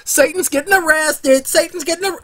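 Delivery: high-pitched gleeful announcing; playful, high crowd-style celebration; mock excitement. Mock cheering at Satan's arrest.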